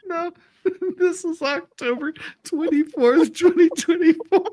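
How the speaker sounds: giggling through the words, pitch high; can't stop laughing while reading it out. Real, not acted. Reading a date to the lawyer, laughing helplessly.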